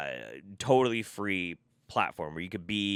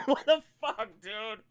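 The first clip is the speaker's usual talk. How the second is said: laughing at the absurdity; laughing disbelief; 11 semitones higher than usual. Real, not acted. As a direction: laugh through your disbelief.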